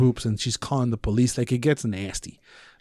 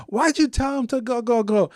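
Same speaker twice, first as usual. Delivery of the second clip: mock neighbor; 9 semitones higher than usual. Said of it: "she's like".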